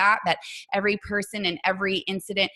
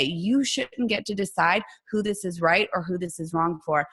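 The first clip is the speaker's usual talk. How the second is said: ordinary delivery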